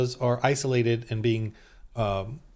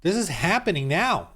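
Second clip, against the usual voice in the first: high, exaggerated gasp of mock surprise; mock shock; 7 semitones higher, slower than usual. Mocking the audience's shocked reaction, a high overdone "Oh". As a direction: act out feigned shock. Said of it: "and you and I are like".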